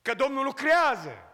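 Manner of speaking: fervent sermon cadence; preaching. Acted. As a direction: proclaim it from the pulpit, building. Preacher proclaims at full force.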